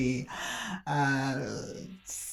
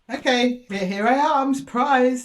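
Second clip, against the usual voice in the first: higher, playful announcement; mock surprise arrival; 6 semitones higher, 4 dB louder, slower than usual. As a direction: announce yourself like a surprise guest. Voices unwanted thing turning up.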